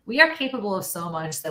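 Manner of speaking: usual speaking voice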